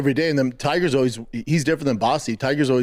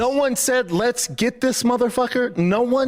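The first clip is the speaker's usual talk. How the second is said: worked-up dad rant; 9 semitones higher, slower than usual. Retold or imitated. Dad ranting at son.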